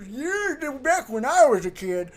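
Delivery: put-on old man voice. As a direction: grumble like a nostalgic old man. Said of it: "I gotta break out the old man voice".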